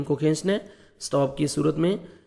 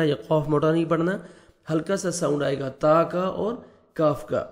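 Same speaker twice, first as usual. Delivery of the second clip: ordinary talk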